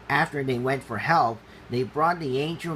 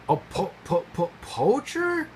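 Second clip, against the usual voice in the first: sputtering; 6 semitones higher than usual. Acted. Impala hearing about poachers, sputtering in fear. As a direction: stutter it out, scared. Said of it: "sputtered Chucky".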